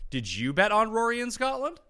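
normal talking voice

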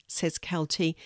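ordinary delivery, nothing marked

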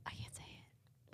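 soft unvoiced slow whisper. Real, not acted. Sister unable to announce their mom's death, whispering slowly.

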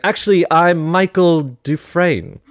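slow, rising, put-on British bluffer; mock confident blagger. British blagger lying to the press tent, hammed-up confidence. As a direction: announce a borrowed name with mock assurance.

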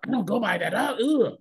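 hammed-up huffy correction, rising to a disgusted ugh; mock attitude. Imitating a touchy stranger correcting a greeting, mock attitude. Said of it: "get attitudes hella quick".

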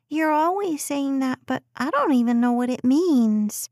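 put-on high little girl, half grinning; teasing child. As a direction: sass like a small kid.

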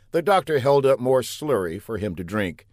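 plain reading voice

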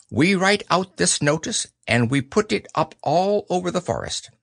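high-pitched owl voice; Owl, businesslike. Acted. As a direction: lay out the plan importantly as an owl.

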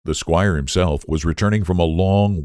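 plain reading voice

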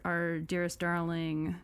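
ordinary delivery

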